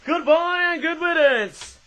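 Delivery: loud, slow, mock farewell; mock dismissal. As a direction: wave it off with a hammed-up goodbye and good riddance. Tossing aside a disliked product.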